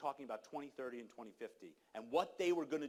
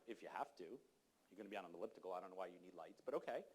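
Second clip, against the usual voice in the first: grudging reply; 8 semitones lower, 8 dB quieter than usual. Retold or imitated. Retelling the early gym chat with a stranger, grudging and low. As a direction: lower and softer, a reluctant grumble. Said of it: "I'm like".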